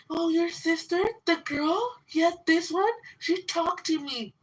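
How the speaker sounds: high, prissy imitation of the friend; mocking quote of friend. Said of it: "she was like".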